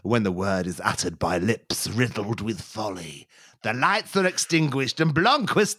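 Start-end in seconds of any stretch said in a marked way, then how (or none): none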